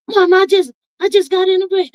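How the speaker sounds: put-on high, shaken young man's voice; son after wreck. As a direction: blurt it out shaken from a crash. Voicing her son after crash.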